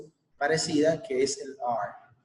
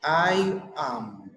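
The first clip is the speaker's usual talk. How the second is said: model for repetition. Shown as a demonstration. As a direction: clear model phrase with a pause after it for the class to repeat. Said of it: "Listen and repeat". (modelo claro para repetir)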